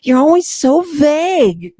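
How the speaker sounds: high, teasing complaint; mock exasperated. Chiding imaginary Alfred, high mock exasperation. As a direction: tease him for being vague.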